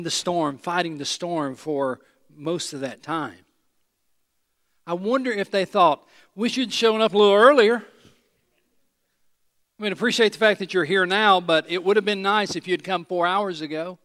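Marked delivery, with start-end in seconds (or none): 6.3-14.0 loud, fed-up disciples grumbling that help came late; exasperated complaint. Acted. Disciples to Jesus, loudly complaining he came late. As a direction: voice the tired crew's sarcastic gripe, loud.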